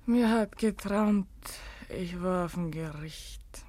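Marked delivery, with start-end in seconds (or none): 1.9-3.6 faint, dreamy whisper; hushed confession; dazed whisper. Acted. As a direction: speak as if half asleep.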